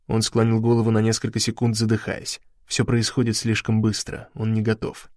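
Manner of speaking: usual speaking voice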